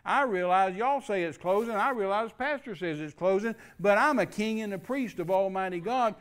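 preacher decreeing over the plant closing; forceful preaching. Retold or imitated. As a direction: declare it with full authority.

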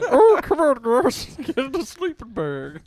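very high, loud cartoon squeal; high-pitched mock coaxing; Meatwad. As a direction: squeal it like a silly cartoon character. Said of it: "Is that your Meatwad impression?".

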